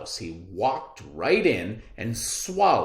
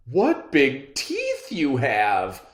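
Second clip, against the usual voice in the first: put-on loud, slow wide-eyed girl; girlish alarm; 6 semitones higher, 10 dB louder, slower than usual. Girl seeing the wolf's teeth, loud slow alarm. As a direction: draw it out, suddenly scared. Girl's voice.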